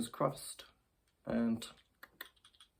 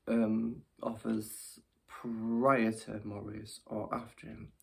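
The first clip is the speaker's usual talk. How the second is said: normal talking voice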